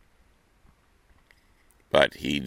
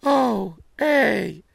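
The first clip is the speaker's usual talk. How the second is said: put-on little girl voice. Girl answers the wolf.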